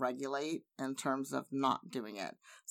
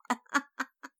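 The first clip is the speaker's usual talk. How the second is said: laughter; 11 semitones higher, 5 dB louder than usual. Real, not acted.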